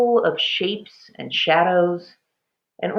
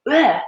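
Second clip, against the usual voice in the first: disgusted bleh; 8 semitones higher, 6 dB louder than usual. A narrator's reading. Spits out salt water.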